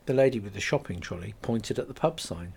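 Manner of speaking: plain reading voice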